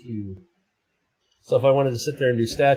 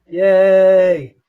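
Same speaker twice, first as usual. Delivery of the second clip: high, loud yay; a bright happy yay; delighted cheer; 7 semitones higher, 9 dB louder than usual. Real, not acted. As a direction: cheer at the angry cat. Delight at cat filter.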